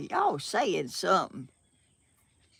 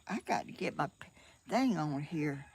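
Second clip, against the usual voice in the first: ordinary talk, nothing marked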